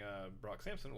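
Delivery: ordinary delivery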